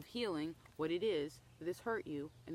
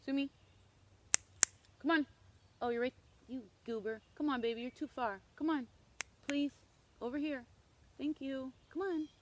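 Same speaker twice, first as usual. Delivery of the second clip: high, bright sing-song coaxing to a dog; 6 semitones higher, 3 dB louder than usual. Real, not acted. Owner sweetly coaxing her dog to come over, high. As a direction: coax the dog over, pitched up.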